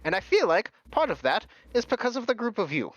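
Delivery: high, cheerful showman; Mister Light, beaming. Acted. Host praising the guests, high and cheerful. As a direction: beam with pride over the vane. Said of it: "he says".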